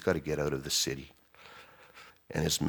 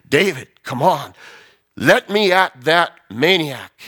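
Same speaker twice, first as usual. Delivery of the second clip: louder, pleading to charge; eager soldiers; 10 semitones higher, 9 dB louder, slower than usual. Retold or imitated. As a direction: beg to be let loose. Loyal men begging to attack.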